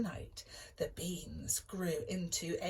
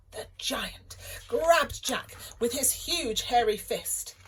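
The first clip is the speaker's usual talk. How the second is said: ordinary delivery, nothing marked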